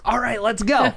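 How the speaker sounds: high, loud pep-up call; hammed-up gung-ho shout; mock eager rallying. Acting out eager reply to frustration.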